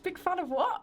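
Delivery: disbelieving, confused; baffled question. Retold or imitated. Telling the singer, disbelieving high question. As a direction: pitch jumps way up on what. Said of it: "and I was like".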